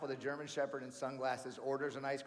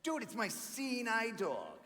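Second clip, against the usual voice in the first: slow, fake-serious insistence; mock earnest; 9 semitones higher, slower than usual. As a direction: sell the lie slowly. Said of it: "I'm like".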